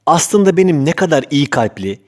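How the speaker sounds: ordinary delivery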